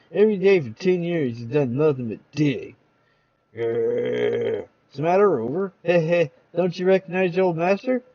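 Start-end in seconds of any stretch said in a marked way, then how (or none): 3.5-4.6 low growling dog. Acted.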